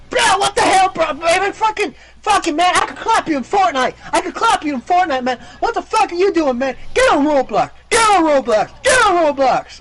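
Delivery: put-on high, loud squeaker-kid tantrum; little kid voice. Killed kid trash-talks his killer, mock tantrum. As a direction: wail it like a furious small child losing a game. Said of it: "when you kill a three-year-old too".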